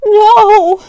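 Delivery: high, loud anguished cry. Acted. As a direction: cry out in despair. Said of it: "Sparktail whined".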